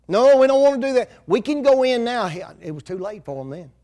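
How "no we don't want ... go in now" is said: higher, louder, fast desperate protest; loud pleading protest. Acted. Israelites begging God to let them in, loud and fast. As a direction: cry out that you'll go now.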